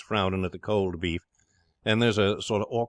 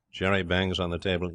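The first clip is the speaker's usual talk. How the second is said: ordinary delivery, nothing marked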